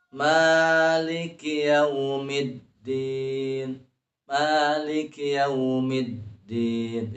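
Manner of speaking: slow chant, doubled d in yaumiddin held; yaumiddin with syiddah held. Shown as a demonstration. Teacher showing correct verse, yaumiddin chanted with held d. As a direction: pause on the d of yaumiddin. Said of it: "dalam kata middin".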